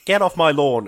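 lightly acted grumpy old-man yell; cranky grandpa. Sith fan acting out Palpatine as cranky grandpa, mildly yelled. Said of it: "Palpatine's like this old cranky grandpa".

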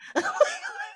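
high squeaky wheezing laugh; a breathless wheeze of laughter; wheezy laugh. Real, not acted. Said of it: "You sound like you're wheezing".

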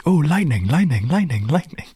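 a hyperfixated god babbling happily; distracted god.

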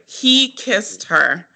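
slow, every word hit; emphatic. Real, not acted. Backing up her co-host that he kissed her, slow and pointed. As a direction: spell it out, slowly and firmly.

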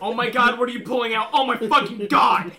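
loud panicked deer, hammed up; mock panic. Acts out deer facing a gun.

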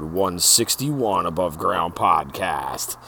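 slow, low announcer intro. Acted. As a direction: deliver it like a show opener.

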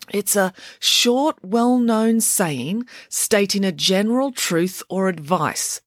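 slow, lilting recital of "short, well-known saying"; schoolchild's sing-song definition, "saying" drawn out. A narrator's reading. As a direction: chant "general truth" like a rote-learned lesson. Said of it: "in a sing-song tone".

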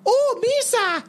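very high, hammed-up alien squeal; Jar Jar Binks. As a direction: imitate a goofy alien with exaggerated pitch. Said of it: "The way he talks".

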